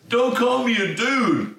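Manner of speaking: high, drawn-out mock outrage; indignant protest. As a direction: imitate someone loudly objecting, pitched high and slow. Quoted protest in retold quarrel.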